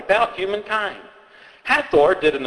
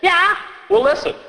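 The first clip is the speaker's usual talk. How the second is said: put-on child voice; 12 semitones higher than usual. Child answering.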